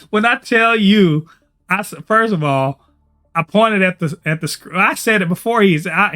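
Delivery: ordinary delivery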